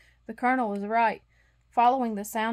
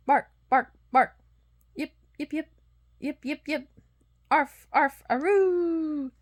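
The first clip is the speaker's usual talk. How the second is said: barks and yips voiced in turns; dogs barking; 5 semitones higher, 5 dB louder than usual. A narrator's reading. Narrator voicing the midnight bark of distant dogs. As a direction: bark and yip like dogs answering far off. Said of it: "At last it came".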